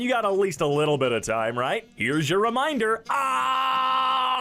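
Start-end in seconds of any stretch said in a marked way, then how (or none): 3.1-4.4 high panicked wail; comic scream. Acted. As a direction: scream out a babbling alarm. Screams as the reminder.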